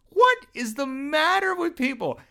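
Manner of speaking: high, slow, disbelieving cry; exasperated. Real, not acted. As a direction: throw it up to the heavens in disbelief. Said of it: "exasperated outburst".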